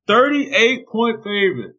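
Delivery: slow, astonished repeat; incredulous echo. Real, not acted. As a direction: say it in disbelief. Echoes in disbelief.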